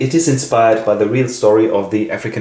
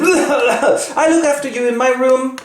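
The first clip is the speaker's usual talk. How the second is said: put-on high, slow, changed girlish voice; a strange childlike tone; voicing Beloved; slower than usual. Beloved speaks to Seth in an odd, high, slow voice.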